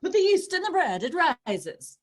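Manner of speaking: high, loud, definite chef voice; confident chef. Retold or imitated. Italian TV chef declaring a kitchen truth, high and loud. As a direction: announce it like an Italian chef who's certain. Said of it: "they're like".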